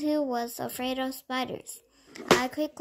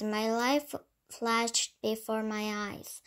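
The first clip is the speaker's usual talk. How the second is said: usual speaking voice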